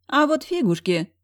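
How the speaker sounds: high, cheeky refusal; scornful snort. A narrator's reading. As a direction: toss it off with mock scorn. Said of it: "фыркнула Зая".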